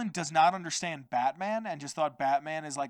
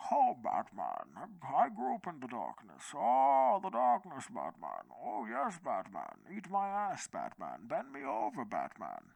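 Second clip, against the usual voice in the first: muffled Bane voice through a hand; hand over mouth; 4 dB quieter than usual. Retold or imitated. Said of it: "I put my hand over my mouth to do the Bane voice".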